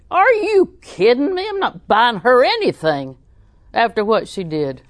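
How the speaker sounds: high, loud outraged quote; indignant disbelief. Retold or imitated. Little lady refusing to buy her relative a flower, outraged. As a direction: scoff at the very idea. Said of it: "She said".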